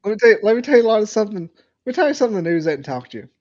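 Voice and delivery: very fast, rushed imitation; fast mock rant of a caller; rapid rant. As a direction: rattle it off at speed. Mimics a ranting caller.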